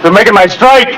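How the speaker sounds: frantic, high-pitched cries; desperate panic. Acted. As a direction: plead wildly, losing control. Carter panics over his claim.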